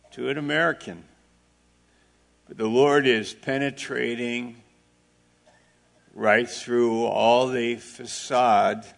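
ordinary talk, nothing marked

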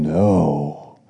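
low, hushed growling no; a sinister devil muttering refusal. Acted. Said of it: "And the devil is saying".

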